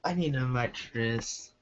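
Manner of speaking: usual speaking voice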